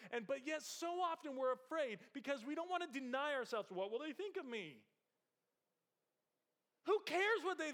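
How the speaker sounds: high and loud, pressing the point; impassioned preaching. Acted. Pastor urging listeners not to fear others, loud and high. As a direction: raise the voice and plead with the room.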